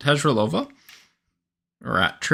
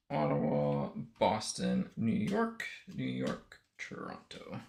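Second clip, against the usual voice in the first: muttered to self; 10 semitones lower, 11 dB quieter, slower than usual. Real, not acted. Muttering teams while sorting cards.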